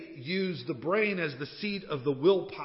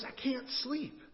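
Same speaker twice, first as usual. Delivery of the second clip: higher, soft exasperated complaint; sleepless husband; 9 semitones higher, 4 dB quieter, slower than usual. Acted. Husband repeating himself to his wife in bed, quietly exasperated. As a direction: repeat it plaintively, keeping the volume down.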